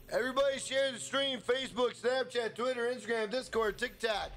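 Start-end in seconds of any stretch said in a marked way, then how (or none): none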